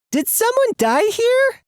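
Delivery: very high-pitched alarmed question; horrified, pitch shooting way up; fearful. A narrator's reading. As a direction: squeak it out in acted fright. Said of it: "looks down at the floor in fear".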